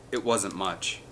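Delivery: plain reading voice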